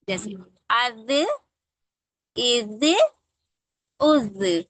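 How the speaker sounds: अज, इज, उज said slowly and distinctly; drilling zaal with vowels. Shown as a demonstration. Teacher drilling letter sounds for children. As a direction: sound out अज इज उज one by one. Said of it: "किसी मखरज को उसका".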